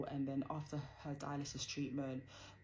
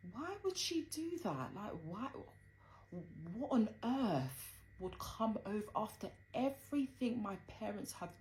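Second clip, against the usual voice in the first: high-pitched, incredulous questioning; indignant disbelief; 7 semitones higher than usual. Retold or imitated. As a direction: demand an answer from the cousin in your head. Retold thoughts about accusing cousin.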